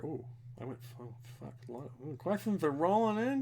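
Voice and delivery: ordinary talk, nothing marked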